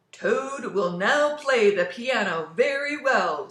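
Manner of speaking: loud, slow, grand presenter voice; booming far-off announcer. Acted. Strange voice presents Toad in his dream, booming announcer. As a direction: announce him like a showman. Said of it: "said the strange voice".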